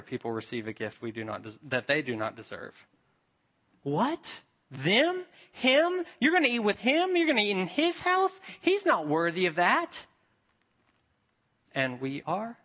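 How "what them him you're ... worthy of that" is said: much higher, louder, scandalized disbelief; mock the outraged grumblers; imitating a huffy religious crowd, voice shooting up; indignant crowd. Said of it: "That's what the crowd is saying".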